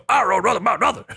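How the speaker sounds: half-hearted squeaky villain; horrible Strong Bad impression. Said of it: "horrible strong bad impression".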